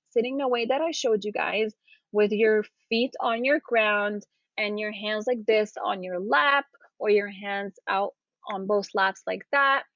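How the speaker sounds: ordinary talk, nothing marked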